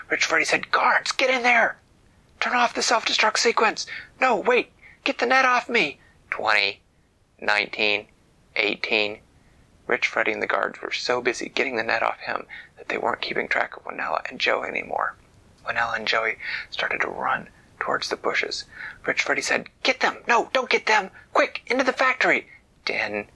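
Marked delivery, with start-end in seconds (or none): 0.7-6.0 high, loud frantic villain; high villain yell. Acted. Villain ordering guards, panicking. 19.8-22.5 high, loud frantic villain; high villain yell. Acted. Villain changes orders.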